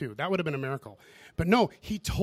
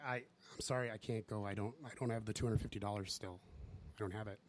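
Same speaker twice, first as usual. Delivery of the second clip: much softer, lower, ashamed apology; quiet, lowered voice of shame; ashamed mumble; 7 semitones lower, 14 dB quieter than usual. Retold or imitated. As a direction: say it softly, eyes down, ashamed. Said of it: "didn't even look at him in the eye 'cause I was so ashamed".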